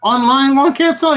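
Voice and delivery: high, slow puzzled question; drawn out, checking who it is. Retold or imitated. Puzzled, asking the caller who he is.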